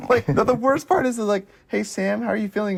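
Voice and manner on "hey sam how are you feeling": high-pitched, put-on check-in question; high voice addressing a kid; officials checking on child. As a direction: ask it as a mock official to a small child.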